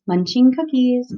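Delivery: normal talking voice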